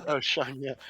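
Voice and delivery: echoed mock London accent; Michael Caine. Friend echoing the star's reply, in mock Cockney. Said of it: "tweet at Michael Caine".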